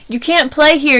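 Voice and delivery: high, loud bully's yell. A narrator's reading. Sammy yells at Kevin by the swings, loud and high. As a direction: shout him off the swings. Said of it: "yelled Sammy".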